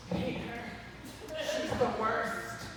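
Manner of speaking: slow, hushed mock loathing; muttered hatred. Teen grumbling about a girl who trash-talked, muttered mock hate. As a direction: seethe it quietly under your breath, play-acted.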